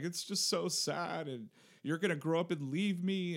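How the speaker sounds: mock tearful dad. Dad retelling crying to his son, mock weepy. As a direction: play up the sobbing parent. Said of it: "And I'm like".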